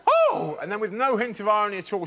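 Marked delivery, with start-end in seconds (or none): 0.1-0.6 high, loud shocked yelp; startled gasp. Retold or imitated. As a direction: jump out of your skin. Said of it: "she said".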